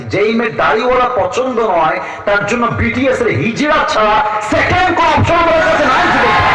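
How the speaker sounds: high, shouted sermon climax; full-force preaching. Acted. Preacher drives home his point to the crowd, shouting.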